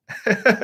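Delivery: quick high burst of real laughter; real laugh at being sold a fake phone; short laugh. Real, not acted. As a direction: laugh at your own bad luck.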